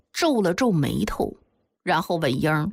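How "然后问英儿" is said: usual speaking voice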